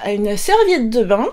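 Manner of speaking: ordinary talk, nothing marked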